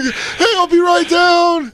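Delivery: hammed-up falsetto shout; mock decoy calling out. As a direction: call down in a silly high voice. Playing pedophile decoy calling out.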